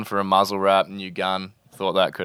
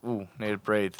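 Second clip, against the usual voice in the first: quiet breathless thought; 7 dB quieter than usual. Retold or imitated. First urge to breathe underwater.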